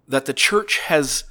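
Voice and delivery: ordinary talk, nothing marked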